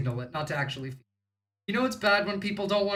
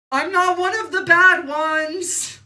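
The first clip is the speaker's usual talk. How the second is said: high, loud and slow, a hammed-up plea; pleading mock voice, higher and louder; 11 semitones higher, 9 dB louder, slower than usual. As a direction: overplay the shame, begging to be believed. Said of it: "you gotta start, you know, like".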